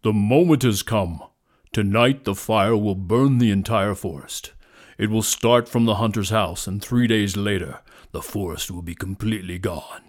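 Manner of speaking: loud, weighty proclamation; deep, powerful lion. A narrator's reading. Lion warns the animals of fire, deep and powerful. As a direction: boom the warning with authority. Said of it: "powerful voice said".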